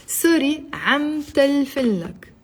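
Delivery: slow teaching demo, word by word; slowly. Shown as a demonstration. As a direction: draw out each Arabic word for learners. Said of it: "I'll say it slowly".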